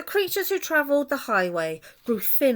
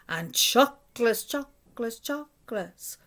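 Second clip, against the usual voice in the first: fading echo; 4 semitones higher than usual. A narrator's reading. Voice in the cave answers, fading echo. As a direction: let each echo die away. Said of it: "the voice from the cave replied".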